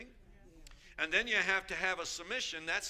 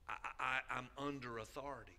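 humble; 2 semitones lower, 5 dB quieter than usual. Retold or imitated. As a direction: say it humbly, meaning it. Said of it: "most humble".

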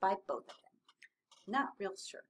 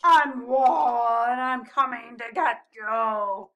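loud, drawn-out playful threat; booming mock-menacing walrus; growling pretend monster; 12 dB louder, slower than usual. A narrator's reading. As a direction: growl it like a lumbering beast. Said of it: "growled Posy".

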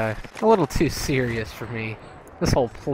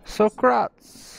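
Bill and Ted voice; 9 semitones higher, 3 dB louder than usual. Retold or imitated. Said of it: "Did you ever see Bill and Ted?".

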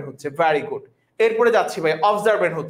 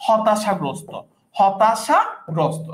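slow; 2 semitones lower, much slower than usual. Shown as a demonstration. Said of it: "অর্থ হচ্ছে ভাইয়া হতাশাগ্রস্ত".

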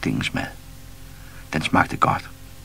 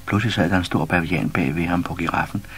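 usual speaking voice

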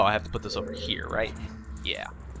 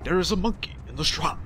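a lightly voiced grand movie-trailer narrator; epic trailer narrator; 3 semitones higher, 4 dB quieter than usual. Gamer narrating the temple as a mock-epic trailer narrator. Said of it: "I can't do that voice very well".

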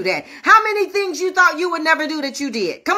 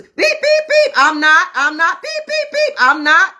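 high-pitched censor beeps between loud denials; mock swearing fit with shrieked beeps; bleeped-out cursing; 4 semitones higher, 4 dB louder than usual. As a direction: curse like a censored broadcast, squeaking the beeps. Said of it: "he started cursing".

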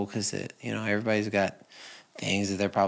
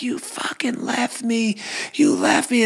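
high, raw, breaking plea at the one who left; anguished cry; 12 semitones higher than usual. Retold or imitated. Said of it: "the emotions that came up".

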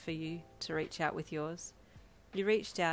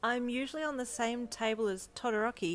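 pitched-up reply over noise; calling back; 6 semitones higher, 4 dB louder than usual. A narrator's reading. Deku answers over the loud music, calling back. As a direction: call it back to your date. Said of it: "he called back".

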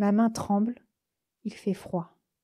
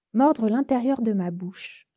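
ordinary delivery, nothing marked

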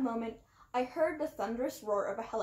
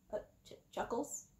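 bewildered whisper; 8 dB quieter than usual. A narrator's reading. Confused stammer, whispered.

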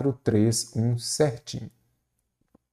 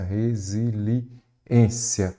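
drawn out; much slower than usual. Shown as a demonstration. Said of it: "teacher drawing out the word".

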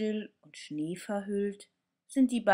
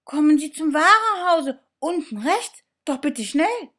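high, loud and fast eager call; thrilled doll calling into the phone; excited calling; 10 semitones higher, 12 dB louder than usual. Acted. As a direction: blurt it out before he hangs up. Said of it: "ruft die Puppe Annabelle".

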